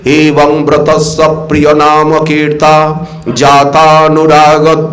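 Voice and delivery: slow, low chanted Sanskrit recitation; measured scriptural chant; verse recitation. Acted. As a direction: intone the verse slowly and deeply.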